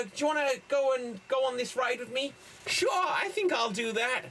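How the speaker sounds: put-on high, eager kid in a rushed skit; young boy at the fair. Boy inviting a girl on a fair ride, eager. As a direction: play the excited kid, pitched high. Acts out a film remake.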